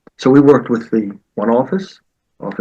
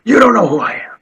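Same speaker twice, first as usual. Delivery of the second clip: loud, higher, the coach shouting his rank; retold angry yell; 9 semitones higher, 4 dB louder than usual. Coach yelling at the referee from the sideline, loud angry shout. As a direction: bark it like an offended veteran. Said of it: "the coach on the side yelled".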